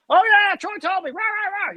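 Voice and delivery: put-on high, excited old-friend gush; imitating Ed's eager welcome. Ed greeting a caller who was sent by Troy, high and gushing. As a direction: gush like you've known him for years. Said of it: "he's gonna act like you're his best friend".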